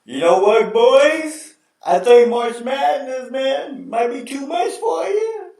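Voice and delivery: high, hammed-up broadcaster; Vitale impression. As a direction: tease the team like an overhyped announcer. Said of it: "He'll be like".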